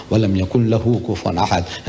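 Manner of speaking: quick clipped Arabic recitation, telegram-like; brisk Arabic chant-like reading; rapid Quran recitation. Retold or imitated. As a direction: rattle off the verse fast in Arabic. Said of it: "he doesn't talk like this".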